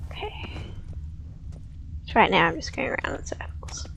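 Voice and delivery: quick unvoiced whisper. Real, not acted. Player whispering that she is going in circles. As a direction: whisper it fast to yourself. Whispered aside while stuck.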